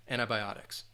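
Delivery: antibiotics the American way, an-tie-by-OT-ics; American pronunciation. Shown as a demonstration. Teacher giving the American English antibiotics, clear model. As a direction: say antibiotics with a long I in anti. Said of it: "but in American English".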